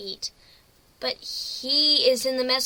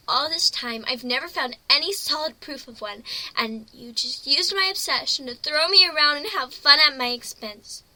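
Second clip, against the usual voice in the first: louder, hurt and angry; angry outburst; 5 dB louder than usual. Acted. Pony accusing the captain of cruel fun, angry. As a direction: vent the betrayal at him. Pony furious at being tricked.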